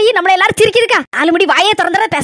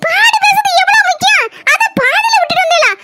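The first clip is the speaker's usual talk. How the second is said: higher, hammed-up whiny bride voice; mocking daughter-in-law; 8 semitones higher than usual. Daughter-in-law mocking mother-in-law's gossip, whiny higher voice. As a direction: whine it sarcastically.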